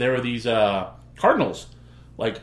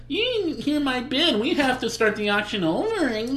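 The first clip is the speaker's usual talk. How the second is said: much higher, slow, whining voice; whiny mock complaint; 10 semitones higher, slower than usual. Sore loser begs auctioneer to restart, high whine. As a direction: play a crybaby begging for a redo. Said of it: "I was not a little pussy who went".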